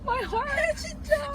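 high, wailing "My heart"; mock heartbroken. Real, not acted. Moaning to friends about the weak friend. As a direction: ham up the heartbreak. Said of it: "This is sadder than the highway story".

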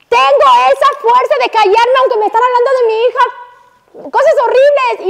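high, strong voice of a woman who holds back; proud silent woman. Acted. Teacher voicing a woman who keeps silent, proud.

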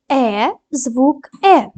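Е drawn out clearly, very slow; slow sound demo. Shown as a demonstration. Teacher models the sound Е for pupils. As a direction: sound out the letter Е slowly. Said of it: "дамо характеристику кожному звуку".

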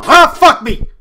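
sudden loud cry of fright; startled yell. Real, not acted. Gamer jolted by a jump scare, yells out. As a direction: yelp as the scare hits.

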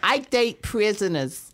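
high, slow, drawn-out caricature; put-on character voice. Teasing the host about the show's guests, high caricature voice. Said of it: "the actors that I brought on".

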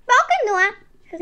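high, loud witch's call; kleine Hexe rufend. A narrator's reading. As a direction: call out defiantly to the broom. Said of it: "rief sie dem Besen zu". (laut und hoch dem Besen zugerufen)

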